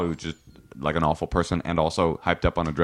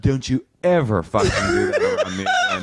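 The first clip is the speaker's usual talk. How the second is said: scolding brother; 4 semitones higher than usual. Retold or imitated. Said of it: "my brother's just like".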